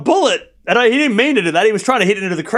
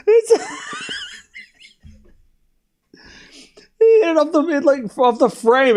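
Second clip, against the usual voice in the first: words squeezed out through high laughter; laughing too hard to get it out; slower than usual. Real, not acted. Retelling the tennis mishit to chat, laughing.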